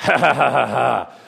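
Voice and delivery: loud mock evil laugh; demonic cackle. Possessed girl laughing, retold.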